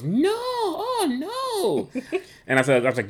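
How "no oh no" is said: grandmother wailing much higher, half laughing; a high, crying-laughing no; mock dismay. Husband imitates grandmother's alarmed protest, much higher. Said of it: "abuela goes".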